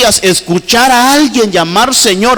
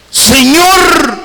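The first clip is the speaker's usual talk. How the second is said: higher pleading call of Señor; the blind men's cry; 7 semitones higher than usual. Retold or imitated. Preacher voicing blind men's cry to Jesus, higher plea. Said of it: "el grito que pegaron ellos".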